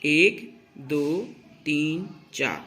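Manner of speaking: slow, deliberate beat count. Shown as a demonstration. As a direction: count each beat slowly and evenly. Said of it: "आप देखेंगे".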